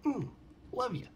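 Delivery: normal talking voice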